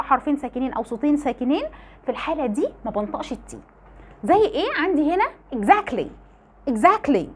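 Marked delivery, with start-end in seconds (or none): 5.5-7.3 exactly said as "exakly", T between C and L gone; quick American exactly; t dropped. Shown as a demonstration. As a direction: skip the T in exactly. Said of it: "ما قالوش".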